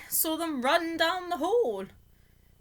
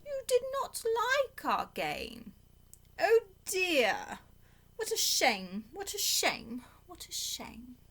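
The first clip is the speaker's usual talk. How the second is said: sorrowful cat; 7 semitones higher, slower than usual. A narrator's reading. The cat sulking after being told off, fading out. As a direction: let the shame trail away to nothing. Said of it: "said the cat".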